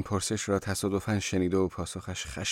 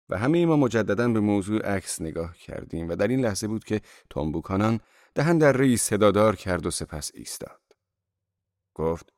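ordinary delivery, nothing marked

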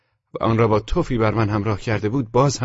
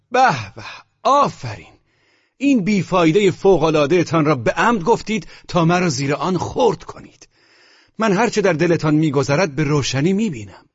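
high, bitterly sarcastic outburst; angry cry; 6 semitones higher, 3 dB louder than usual. A narrator's reading. Wounded lover accuses her of crushing him, crying out. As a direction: cry out mock praise, raised and indignant. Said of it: "من فریاد زدم".

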